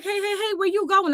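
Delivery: high-pitched heroine stopping someone; acted calling out. Wisp calls to a fleeing sprite, high voice. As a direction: call after them in a lightly acted high voice.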